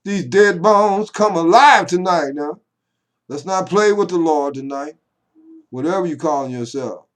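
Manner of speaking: loud, high revival preacher; fired-up preaching. Pretend. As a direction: whip up the congregation at full force.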